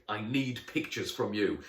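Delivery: ordinary delivery, nothing marked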